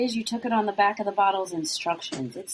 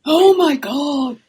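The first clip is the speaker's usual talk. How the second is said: slow, drawn-out, louder and higher gasp of disbelief; real shock; 5 semitones higher, 10 dB louder, much slower than usual. Real, not acted. Cohost hearing someone drank oregano oil, slow stunned disbelief. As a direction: let it stretch out, stunned.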